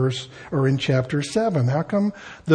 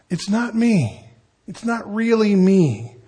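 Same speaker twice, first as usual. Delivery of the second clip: higher-pitched pleading denial; mildly impassioned, as a saint disowning a failing; passionate protest; 4 semitones higher than usual. Acted. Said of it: "may say it in a moment of passion".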